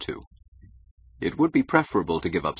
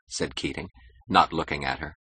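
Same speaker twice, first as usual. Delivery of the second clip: plain reading voice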